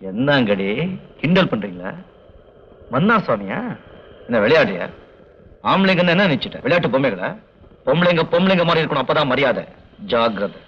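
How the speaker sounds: stern threatening rebuke; angry scolding. Acted. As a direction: warn them off sternly. Angry man scolds women.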